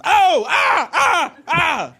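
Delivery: high startled shrieks; scared shriek. Retold or imitated. Imitating daughter startled by a bush, frightened shrieks. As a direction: shriek like a spooked toddler. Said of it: "and she goes".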